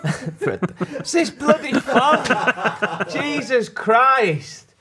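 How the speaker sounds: high, drawn-out words through laughter; helpless laughter. Real, not acted. Podcaster laughing at a co-host's fumble, helpless laughter. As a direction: exclaim while cracking up, stretching the words.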